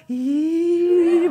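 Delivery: long, high droning "eee"; imitated eerie noise. Imitating the leader's scary loudspeaker sound, eerie drawn-out squeal. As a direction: draw out a spooky speaker noise. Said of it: "막 이런 소리를".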